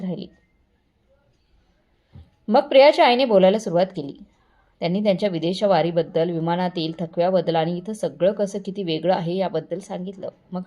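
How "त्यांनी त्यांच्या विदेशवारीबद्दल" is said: plain reading voice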